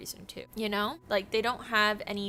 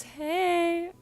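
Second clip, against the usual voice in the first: put-on coy request; 7 semitones higher, 4 dB louder, slower than usual. Voicing her asking to touch.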